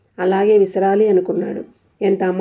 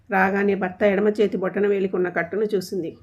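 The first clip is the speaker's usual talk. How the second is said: ordinary delivery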